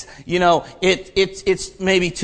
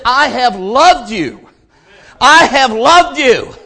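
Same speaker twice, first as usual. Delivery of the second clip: slow, higher, louder proclamation of God's love; preaching at full force; 5 semitones higher, 8 dB louder, slower than usual. Acted. Preacher proclaiming God's love to complainers, rising to a shout. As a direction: build from firm to ringing.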